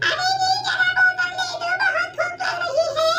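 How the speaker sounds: high, put-on old woman's voice; squeaky elderly saas, grumbling; old mother-in-law. (ऊँची आवाज़ में बूढ़ी सास)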